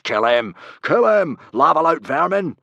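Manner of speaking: loud, high mob battle cry. A narrator's reading. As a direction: yell for blood like an angry mob. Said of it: "while crying out".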